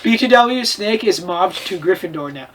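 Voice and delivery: ordinary delivery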